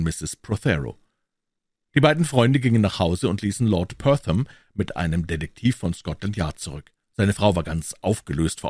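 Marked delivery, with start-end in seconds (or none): none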